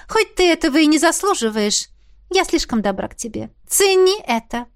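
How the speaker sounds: high, condescending princess voice; haughty princess. A narrator's reading. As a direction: talk down to him grandly.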